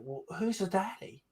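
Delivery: high, teasing question; playful dig, pitched high; joking jab. Retold or imitated. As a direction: tease them with a mock-innocent question. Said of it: "I was like".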